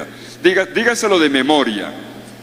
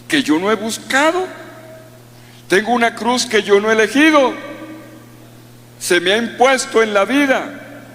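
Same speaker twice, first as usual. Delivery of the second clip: higher, pleading protest of a burdened believer; troubled parishioner; 7 semitones higher than usual. Retold or imitated. A parishioner objecting to the priest, higher pleading protest. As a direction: plead like a worried churchgoer asking the priest. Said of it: "Yo sé que la están pensando algunos".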